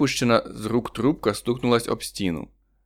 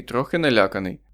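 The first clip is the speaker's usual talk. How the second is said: ordinary delivery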